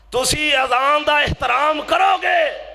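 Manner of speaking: high, slow preacher's shout; ringing pulpit cry; loud exhortation. Real, not acted. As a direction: call it out to the congregation, drawn out.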